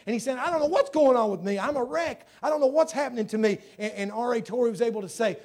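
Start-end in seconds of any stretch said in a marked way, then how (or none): 0.4-3.6 high, loud retold distress; urgent mock-distraught cry; shaken outburst. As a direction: retell a man at a loss, voice pushed up and loud. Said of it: "he's quivering, he's shaking".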